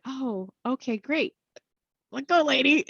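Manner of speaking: high, strained polite "okay, great"; mock desperate to get away; awkward eagerness to escape. Speaker wants to escape.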